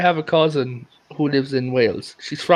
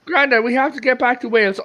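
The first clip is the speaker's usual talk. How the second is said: put-on higher, pleading girl's voice; pleading granddaughter; 8 semitones higher than usual. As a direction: urge grandad home, pitched high.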